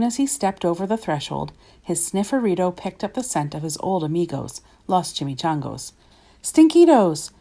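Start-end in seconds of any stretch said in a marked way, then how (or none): 6.4-7.3 high, loud gleeful call. A narrator's reading. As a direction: call out to friends you can't see. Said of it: "called out Skippy John Jones".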